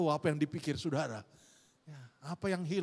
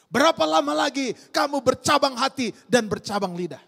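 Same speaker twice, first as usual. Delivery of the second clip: quoting the preacher; 10 semitones higher, 9 dB louder than usual. Retold or imitated. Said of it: "Dia bilang begini".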